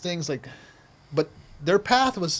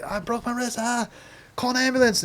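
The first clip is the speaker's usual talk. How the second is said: mock pained cry; 6 semitones higher than usual. Kid with broken wrist begging for help, mock pained cry. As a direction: act out hurt panic without full volume. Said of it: "screaming 'cause my hand was broken".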